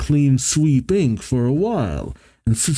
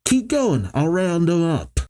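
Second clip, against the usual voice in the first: higher-pitched call, no real shout; 6 semitones higher than usual. A narrator's reading. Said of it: "Jet calls out".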